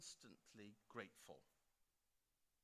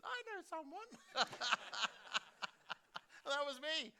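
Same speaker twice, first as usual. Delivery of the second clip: laughing; 8 dB louder than usual. Retold or imitated. [name] answers, speaker laughing.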